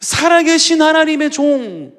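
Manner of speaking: high, loud, slow declaration; awed proclamation. Shown as a demonstration. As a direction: proclaim it like a confession of faith. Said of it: "지금 왕이 뭐라고 하냐면".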